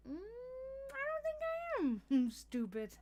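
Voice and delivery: long high hum into slow mock pondering; playful doubt. Worker answering idea she's stupid, playful doubt. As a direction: draw it out high, as if weighing it.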